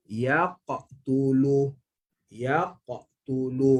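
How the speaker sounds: Yaqtulu drawn out very slowly, qaf bounced; slow teacher's model of Yaqtulu; slow recitation demo. Shown as a demonstration. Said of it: "maka bunyi dia macam mana?".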